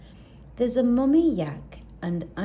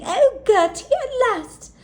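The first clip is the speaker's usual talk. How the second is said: high, loud relieved call; a very high, loud joyous shout; relieved cry; 9 dB louder than usual. Acted. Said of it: "Mummy yak grunting".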